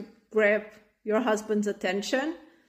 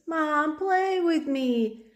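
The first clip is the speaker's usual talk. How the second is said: put-on higher, slow, whining little kid; whiny child; 6 semitones higher, much slower than usual. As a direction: drag it out like a bored child begging. Child voiced, whining for play.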